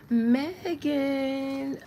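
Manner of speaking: high, drawn-out cheery call; chippery and happy. Acted. Reader calls up [name], chippery and happy. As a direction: sing out the name brightly, stretched. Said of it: "like she all chippery and happy".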